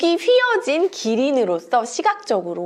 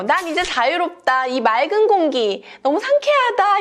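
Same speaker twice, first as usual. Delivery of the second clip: higher-pitched, mild storybook delight; joyful relief; 7 semitones higher than usual. Acted. Giraffe freed into clean air, mildly joyful relief. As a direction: revel lightly in new freedom, pitch climbing.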